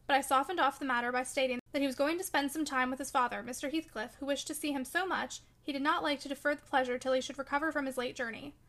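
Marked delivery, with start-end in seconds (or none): none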